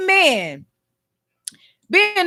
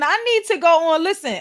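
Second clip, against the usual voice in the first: usual speaking voice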